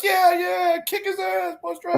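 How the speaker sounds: very high mock kid cheering; high-pitched imitation of rowdy students; cheering high school kids. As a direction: egg the driver on like excited kids. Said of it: "'cause they're all like".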